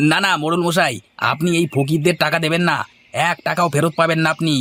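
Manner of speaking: high, fast wheedling protest. Acted.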